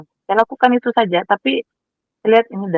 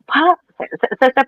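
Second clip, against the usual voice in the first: high cry of Pak in joy; calling out to father in delight; excited call; 7 semitones higher than usual. Retold or imitated. (memanggil bapak dengan gembira, nada tinggi)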